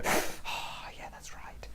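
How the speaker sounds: soft embarrassed aside; a quiet sheepish brush-off; 1 sheepish mumble. Retold or imitated. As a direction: mumble it, caught out. Sheepish sarcastic reply.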